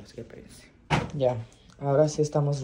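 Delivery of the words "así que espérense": whispered aside. Real, not acted. Aside to viewers.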